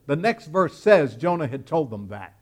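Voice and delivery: ordinary talk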